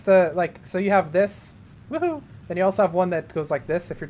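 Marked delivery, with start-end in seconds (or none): 1.9-2.2 high-pitched little woohoo; playful whoop. Real, not acted. Playful whoop while explaining orbit.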